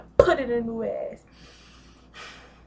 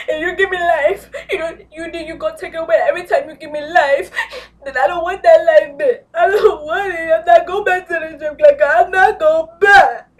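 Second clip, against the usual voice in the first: imitating an ugly cry, much higher and louder; hammed-up crying impression, pitched way up and loud; mock sobbing; 7 semitones higher, 12 dB louder than usual. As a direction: wail it like she's bawling on TV. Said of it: "she sounded like".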